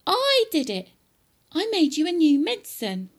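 high, loud, insistent George; indignant high-pitched boy's shout. Acted. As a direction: shout back up at Grandma. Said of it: "George shouted".

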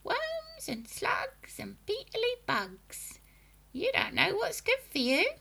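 put-on high, hissed old hag voice; creepy witch relishing bugs; witchy grandma voice. A narrator's reading.

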